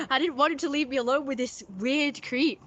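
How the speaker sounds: ordinary delivery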